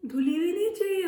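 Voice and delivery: whiny monkey refusing a washed shirt; petulant refusal. Acted. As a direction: sulk like a spoiled child. Monkey demands new shirt.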